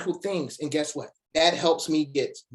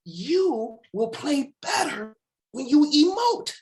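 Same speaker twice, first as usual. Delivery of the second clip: emphatic encouragement; 11 semitones higher, 4 dB louder, slower than usual. Retold or imitated. Father encouraging son.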